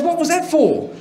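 high-pitched mock outrage; imitated indignant protest. Joke teller as husband just slapped, imitated indignation.

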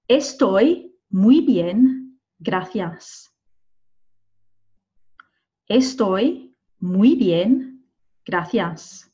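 very slow, careful listening-test delivery; slow dictation. Shown as a demonstration. Teacher reading a listening exercise aloud, very slowly. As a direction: spell each word out with your voice for learners. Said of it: "I'll say them all at least twice".

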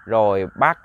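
plain reading voice

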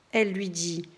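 ordinary delivery, nothing marked

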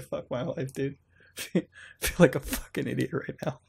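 soft, laughing under the breath; embarrassed laughing mutter. Real, not acted. Streamer chuckling embarrassed after the ghost joke. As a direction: laugh quietly at your own groan.

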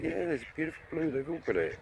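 usual speaking voice

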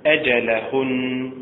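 أجلهن slow, stress on the last vowel; final-vowel stress. Shown as a demonstration. Teacher demonstrating pausal stress to students. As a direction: draw out أجلهن, stressing the end. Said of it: "فالنبر فيه على الحركة الأخيرة".

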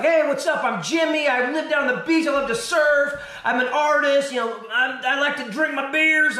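mock audition energy, a notch up from plain talk; over-eager surfer host. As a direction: sell yourself like a beach-bum TV host. Actor switching on for the camera.

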